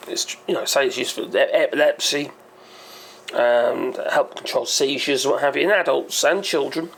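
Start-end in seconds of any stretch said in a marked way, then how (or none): none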